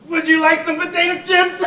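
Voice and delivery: high, loud tearful offer breaking into sobs; crying while talking. Acted. Weeping host offering guests potato chips, bursting into sobs. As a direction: try to play host through the tears. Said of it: "you mustn't be ashamed to cry".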